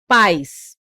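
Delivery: pais in one loud syllable, falling. Shown as a demonstration. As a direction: say pais unbroken, ai as one glide. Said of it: "A gente tem um monossílabo".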